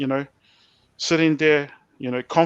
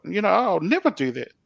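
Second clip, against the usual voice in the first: normal talking voice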